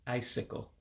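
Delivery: ordinary talk, nothing marked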